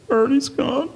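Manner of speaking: high, weepy mock sob; a hammed-up tearful wail; imitating mourners crying. Mourners at the coffin, a high mock-tearful wail.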